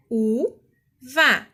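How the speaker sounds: "u-va" as two separate beats, pause between; split into syllables. Shown as a demonstration. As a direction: sound out "u-va" syllable by syllable. Teacher demonstrates splitting into syllables.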